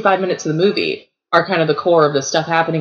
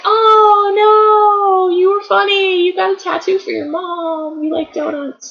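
mock crying; 12 semitones higher than usual. Mock grief at character's death.

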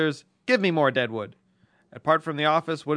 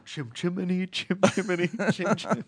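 put-on Cockney accent; 4 semitones higher, 3 dB quieter than usual.

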